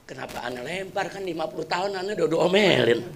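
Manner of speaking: hushed, sorrowful prayer to himself; quiet, grieving lament; sad. Acted. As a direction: murmur it low, heartbroken and regretful. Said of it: "hatinya sedih".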